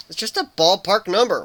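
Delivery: indignant huffing protest; huffy protest. A narrator's reading. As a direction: defend himself, huffing. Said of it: "Warren protested, huffing".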